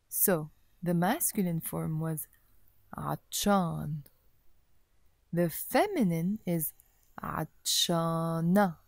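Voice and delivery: normal talking voice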